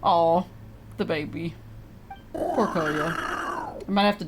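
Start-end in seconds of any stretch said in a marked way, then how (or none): none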